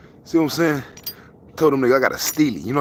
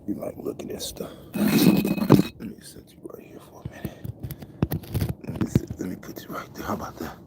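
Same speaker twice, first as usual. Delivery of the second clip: whisper; 8 semitones lower, 4 dB quieter than usual. Real, not acted. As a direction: coo it gently under your breath. Whispering to a pet.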